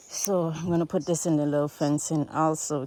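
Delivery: ordinary talk, nothing marked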